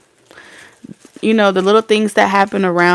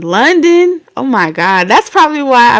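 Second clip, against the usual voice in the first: higher, louder, delighted disbelief; excited surprise; 5 semitones higher, 7 dB louder than usual. Real, not acted. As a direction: react amazed, pitch leaping up on the first word. Reacting to a viewer from London.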